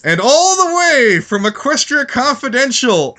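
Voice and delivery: high, slow, drawn-out introduction; big stretched-out announcer voice; hyped announcer intro. Pretend. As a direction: announce the guest like a ring announcer.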